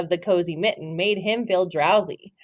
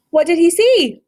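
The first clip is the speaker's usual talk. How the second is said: gasp of surprise; 9 semitones higher, 5 dB louder than usual. Real, not acted. Teacher gasps, excited question.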